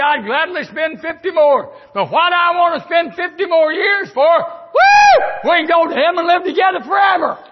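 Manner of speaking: building up to a high whooping cry; joyful preaching climax. Real, not acted. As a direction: let the joy burst out at the end.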